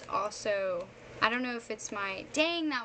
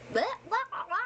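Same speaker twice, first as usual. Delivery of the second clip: mock babble; 9 semitones higher, much faster than usual. Shown as a demonstration. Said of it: "I do, I'm".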